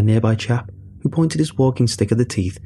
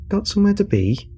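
teasing; 10 semitones higher than usual. Acted. Teasing old man in queue.

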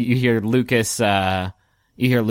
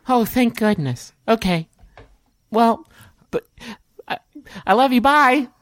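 put-on high, halting child voice; relieved little boy; 10 semitones higher than usual. Boy says love you and bye, high halting voice.

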